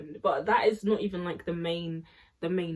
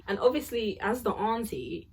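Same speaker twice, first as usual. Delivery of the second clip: ordinary talk, nothing marked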